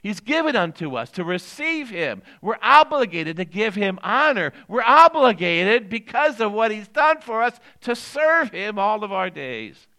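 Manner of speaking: loud, weighty preacher's cadence; full-force preaching. Real, not acted. Pastor proclaiming a message for the whole world, preaching. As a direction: drive each phrase home to the congregation, loudly.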